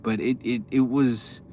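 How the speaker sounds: normal talking voice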